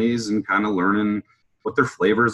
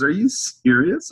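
high, drawn-out incredulous question; retold chuckling disbelief; amused disbelief; 11 semitones higher than usual. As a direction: ask it slowly, amused and pitched up. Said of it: "he looked at me and he kinda laughs".

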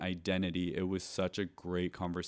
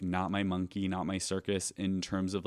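ordinary delivery

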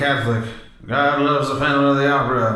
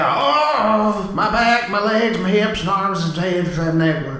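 high strained cries of pain; pained groaning; 8 semitones higher, slower than usual. Acted. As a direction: yelp as everything hurts. Tries a kick, hurts himself.

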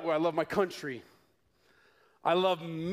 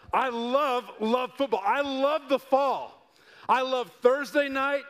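rising, louder, eager voice about football; preacher lights up about his passion; excited gushing; 6 semitones higher, 4 dB louder than usual. Real, not acted. Pastor tells the church about football, getting louder and excited.

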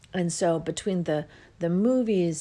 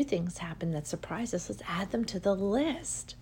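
whisper; 4 dB quieter than usual. Real, not acted. Confiding a new idea.

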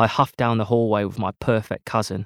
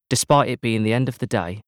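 plain reading voice

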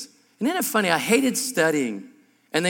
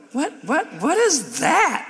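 high, slow, loud incredulous what; baffled at a limp handshake; appalled and mocking; disgusted disbelief; 13 semitones higher, 4 dB louder, slower than usual.

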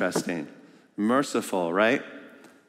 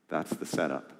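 ordinary delivery

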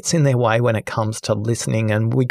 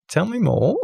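"Tell me more" pitched high with a rising question; 8 semitones higher than usual. Retold or imitated. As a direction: toss it up like an eager question. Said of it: "Incorrect answer".

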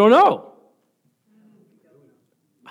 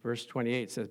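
ordinary talk

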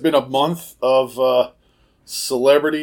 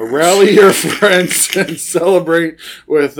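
reread, louder, breaking into laughter; laughing retake; 3 semitones higher, 5 dB louder than usual. Shown as a demonstration. Rereading beer label to cohost, laughing. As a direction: try again, laughing through it.

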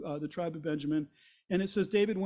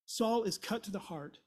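normal talking voice

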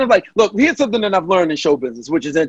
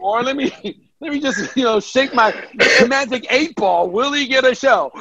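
high mocking sarcastic voice; dripping sarcasm, pitched up; 6 semitones higher than usual. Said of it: "she very sarcastically".

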